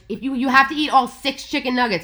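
lightly voiced mom laying down the law; nagging mom. Mother ordering her kid to finish the nuggets, mock firm. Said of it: "I remember women be".

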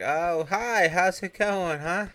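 high, loud, overly innocent hello; fake casual greeting. Harmony greeting them after hiding toys, fake casual. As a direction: imitate someone covering up with cheer.

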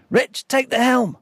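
high, drawn-out call across deck; shouted order. A narrator's reading. Skipper calling out orders at sea.

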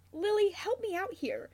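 high, playful plea; appealing. A narrator's reading. Marlene asks Lily to help her decide, appealing. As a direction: call on a friend for backup.

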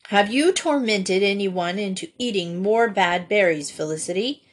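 ordinary delivery, nothing marked

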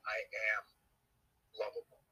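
weight on am in I am lovable; a steady affirmation; stress on AM. Shown as a demonstration. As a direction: hit AM, then lovable lifts up. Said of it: "the emphasis is on am".

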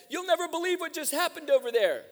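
high-pitched, acted amazement; excited retold opener. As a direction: pitch it high like someone bursting with news. Friend's opener to a stranger.

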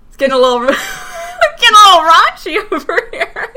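giggling through the words; laughing. Real, not acted. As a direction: laugh while you say it. Laughing at own joke.

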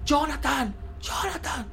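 high, frantic mother calling her son's name; desperate mother calling. Retold or imitated. Mother searching for her sleepwalking son, high desperate calls. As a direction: call out for a lost child, imitating mom.

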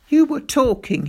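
loud, high accusation; barked. A narrator's reading. Captain accusing pupil of talking, barked. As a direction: bark it out like a drill officer. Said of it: "he barked".

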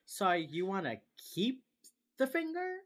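high, loud, incredulous questioning with laughter; hammed-up awkward going along with it; mock bafflement. Host mimicking agent humouring a wife's odd request, mock bafflement.

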